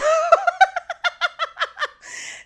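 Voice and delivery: loud, high burst of laughter; real laughter. Real, not acted. Storyteller amused at her own reply, real high laughter. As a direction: crack up in a long high peal.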